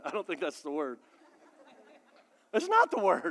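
chuckling through the words; laughing. Real, not acted. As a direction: laugh as you take it back. Laughing at own joke.

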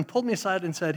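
usual speaking voice

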